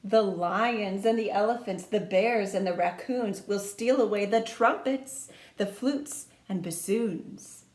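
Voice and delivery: ordinary delivery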